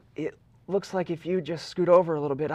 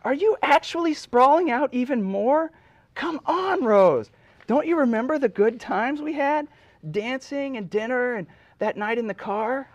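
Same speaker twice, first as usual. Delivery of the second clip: higher, louder pleading Jack; high exasperated plea; 11 semitones higher, 4 dB louder, slower than usual. Acted. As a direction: whine at her to scoot over. Jack begging Rose for room.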